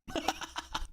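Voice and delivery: quick breathy laugh. Real, not acted. Laughs at own joke.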